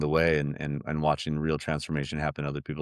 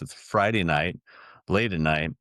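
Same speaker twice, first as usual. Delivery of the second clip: normal talking voice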